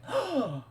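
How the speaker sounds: high sharp gasp. Real, not acted. Gamer sees a shock on screen, high sharp gasp.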